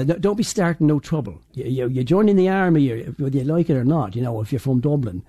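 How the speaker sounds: Dublin-style Irish dialect; southern Irish accent. Shown as a demonstration. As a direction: demonstrate a south of Ireland accent. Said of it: "like, from the south of Ireland".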